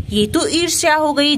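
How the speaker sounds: ordinary delivery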